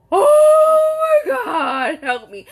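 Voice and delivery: mock terrified shriek, high and loud; panicked scream. Mom screaming at her son's wild turn, panicked. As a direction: scream for help in the passenger seat. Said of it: "And I'm like".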